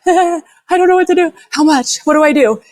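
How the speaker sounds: high-pitched, helpless mock panic; retold panic. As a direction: replay a panicked plea, voice jumping way up. Retells panic over dosing the dog.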